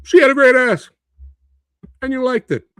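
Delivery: high, loud mock Pacino line; hammed-up limp Pacino yell; watered-down Pacino. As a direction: spoof the sanitized version. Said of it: "that's what's in the movie now".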